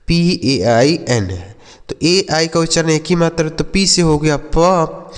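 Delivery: ordinary talk, nothing marked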